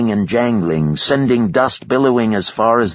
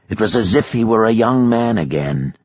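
usual speaking voice

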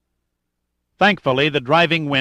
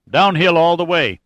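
higher, louder call to companions; calling over wind; 5 semitones higher, 5 dB louder than usual. A narrator's reading. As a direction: project it over the howling wind. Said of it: "he said over the howl of the wind".

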